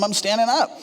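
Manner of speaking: normal talking voice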